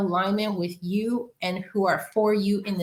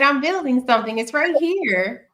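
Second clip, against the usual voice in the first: lightly voiced cat; kitty voice; 6 semitones higher than usual. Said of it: "As my kitty likes to point out".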